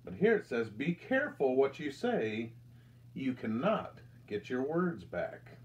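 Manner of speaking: plain reading voice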